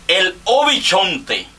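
mock ring announcer intro; announcer call. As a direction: stretch the name out like a ballpark announcer. Announces next batter like ballgame.

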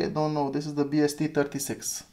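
ordinary delivery

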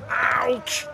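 high yelp of pain; pained cry. Acted. Character hit by falling bricks.

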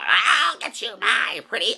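mock cackling witch, high. Fan quoting favorite witch, mock high witch voice. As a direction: snarl it like the Wicked Witch. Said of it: "We all know my favorite character is the witch".

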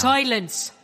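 sudden loud villain bark; villain's shout. Acted. Pirate villain silencing her captives, loud. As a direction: cut them off with a commanding yell.

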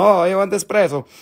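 high, hammed-up Italian accent. Shown as a demonstration. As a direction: offer it like an excitable mock Italian. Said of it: "then we go to Italiano".

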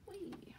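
tiny high, soft whee; playful whee. Real, not acted. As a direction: let out a small, very quiet high whee. Playful toss while adding purple wool.

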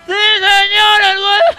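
very high, loud screeching panic; screeching customer. Acted. Customer screaming in the restaurant, very high shriek. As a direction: shriek it as if in pain. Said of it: "Y el señor dijo".

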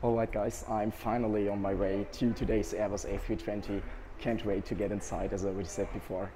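low, worn-out murmur; tired quiet voice. Real, not acted. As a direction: talk softly, running on empty. Tired, quiet walk to the aircraft.

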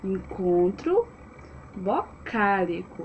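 slow, word by word with a pause between; slow repeat-after-me. Shown as a demonstration. Teacher asking the class to repeat the new term, slow and measured. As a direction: model each word slowly for repeating. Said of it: "Repete comigo".

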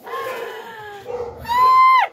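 loud high excited shriek; excited squeal. Real, not acted. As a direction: squeal with delight. Thrilled by the bag placement.

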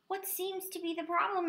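high-pitched doctor character; doctor's question. A narrator's reading. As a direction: inquire as the doctor, pitched well up. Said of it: "asked the doctor".

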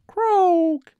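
very high, loud croaking raven cry; the raven cries out, loud and high. Acted. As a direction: squawk it like a raven.

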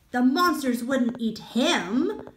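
usual speaking voice

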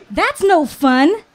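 high, loud, drawn-out complaint; lively storybook crowd voice, loud and high; carefree protest. A narrator's reading. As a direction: protest loudly, pitch up, stretching the words. Islanders dismissing the wait, voiced.

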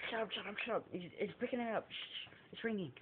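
urgent hushed whisper to friends. Retold or imitated. Friends hushing each other during a prank call, whispering. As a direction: hiss it so the phone won't hear.

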